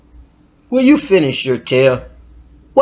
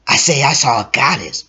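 usual speaking voice

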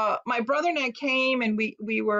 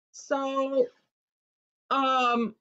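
ordinary talk

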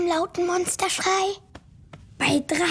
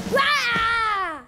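monster roar; 5 semitones higher than usual. Shown as a demonstration. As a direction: belt out a big scary Wah. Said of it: "Und ein lautes Wah!".

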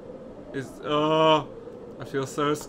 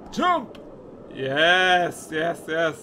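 usual speaking voice

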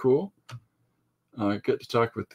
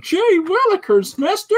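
put-on high, excited kid voice; gee-whiz kid exclaiming; wholesome 1950s kid; 7 dB louder than usual. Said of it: "a drawing of a kid going".

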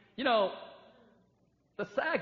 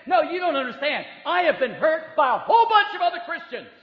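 higher, louder indignant protest, mocking the objector; 7 semitones higher, 13 dB louder than usual. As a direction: whine back that you're the victim, hammed up. Mimicking objector arguing back.

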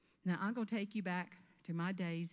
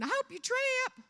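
high, loud, drawn-out mock sweetness; hammed-up sugary spite; gleefully catty thought; 7 dB louder than usual. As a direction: gush the ill wish in the same fake squeal. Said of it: "the whole time you're thinking".

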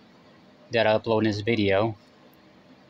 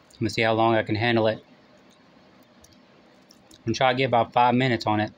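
ordinary talk

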